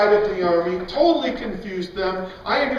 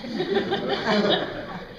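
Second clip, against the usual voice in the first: soft high laugh; 10 semitones higher, 4 dB quieter than usual. Real, not acted. Laughs after punchline.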